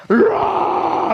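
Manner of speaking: loud, high mock bawl; imitated crying groan. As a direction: roar out a big imitated sobbing wail. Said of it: "groaning and crying".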